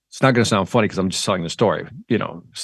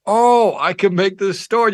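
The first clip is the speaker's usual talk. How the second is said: a higher-pitched, delighted sudden idea; light up with a new idea, pitch jumping up; excited realization; 8 semitones higher, slower than usual. Acted. Storyteller acting out spotting a story, excited realization.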